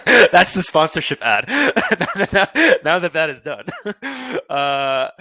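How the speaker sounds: laughing through the words, slow and loud. Real, not acted. Host laughing to his guest now the sponsor read is done. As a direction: chuckle it out, relieved the ad is over.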